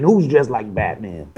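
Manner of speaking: low, slow mafia goon; tough goon voice. Acted. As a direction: lean into a low slow tough-guy read. Mafia goon line re-enacted.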